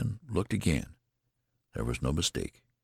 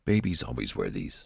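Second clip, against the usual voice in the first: lower, prim nurse; 5 semitones lower than usual. Acted. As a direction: state it with proper reserve. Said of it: "said the nurse primly".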